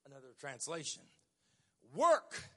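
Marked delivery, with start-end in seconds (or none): 1.9-2.5 "Work" flung out high and loud; punched word. Shown as a demonstration. Preacher snapping one word of the verse at the church. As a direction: hit "Work" hard with a lift. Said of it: "I'm gonna give you another translation".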